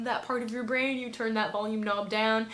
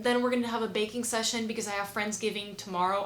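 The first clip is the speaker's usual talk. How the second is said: normal talking voice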